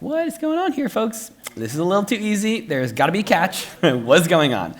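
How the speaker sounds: mock-suspicious shock at the grandmother; incredulous. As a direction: sound stunned that it's this easy. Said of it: "I'm also like".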